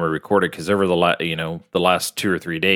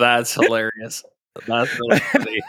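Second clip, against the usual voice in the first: laughing; 3 semitones higher than usual. Real, not acted. Still laughing at intro.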